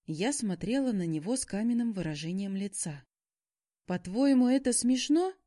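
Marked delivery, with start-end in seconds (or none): 3.9-5.3 icy question, raised and high. A narrator's reading. Girlfriend challenges Shaun's joke, icy tone. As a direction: demand an answer with cold indignation. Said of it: "спросила я ледяным тоном".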